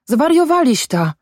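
high-pitched outburst; crying out. A narrator's reading. Son cries out at his father, high-pitched protest. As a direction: exclaim in disbelief, voice pitched right up. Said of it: "krzyknął".

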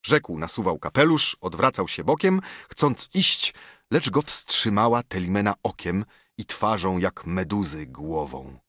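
ordinary delivery

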